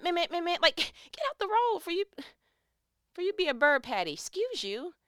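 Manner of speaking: mock yell. Yelling at vulture from car.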